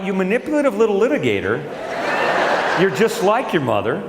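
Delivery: high, laughing mock scolding. Father amused at his scheming son, laughing. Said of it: "And I thought".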